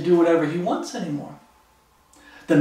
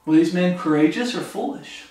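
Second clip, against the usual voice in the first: ordinary talk, nothing marked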